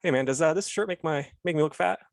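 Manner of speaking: ordinary talk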